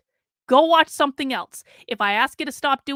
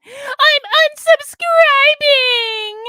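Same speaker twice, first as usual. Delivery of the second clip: high, loud, drawn-out mock wail; mock crybaby; 10 dB louder, much slower than usual. Streamer mocking a viewer who quits, loud crying whine. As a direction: wail it slowly like a tantrum.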